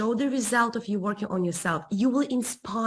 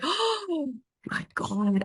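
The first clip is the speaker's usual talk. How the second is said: gasping realization; 6 semitones higher, 3 dB quieter, much slower than usual. Retold or imitated. Inner panic at the suitcase.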